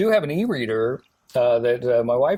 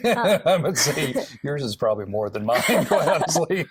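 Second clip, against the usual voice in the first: laughing through the words; 3 semitones higher than usual. Real, not acted. Laughing at 12-year-old's joke.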